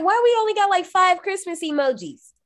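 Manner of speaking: high-pitched exasperated whine; mock indignant complaint. Quoting own complaint about emoji choice.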